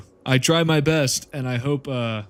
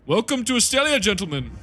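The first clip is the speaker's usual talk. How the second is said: grand welcome; 9 semitones higher than usual. Acted. Streamer greets viewers grandly.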